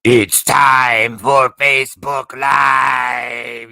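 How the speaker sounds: slow, loud drawn-out mock of his accent; mocking Bob's accent. Host parodies Bob's accent to his co-host, loud slow mockery. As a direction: ham up his accent, shouting every word. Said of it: "what accent are you talking about".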